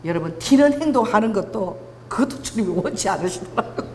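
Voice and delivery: ordinary talk, nothing marked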